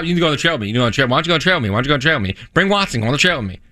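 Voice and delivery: low, rattled-off mock nagging; nonstop pestering. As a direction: imitate a wife's repetitive nagging. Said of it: "She bugs me nonstop".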